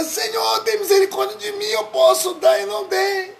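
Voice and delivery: high, shouted plea for mercy; desperate cry of a repentant believer; anguished plea. Retold or imitated. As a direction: beg the Lord at the top of the voice. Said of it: "Outro dizia".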